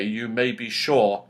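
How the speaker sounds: plain reading voice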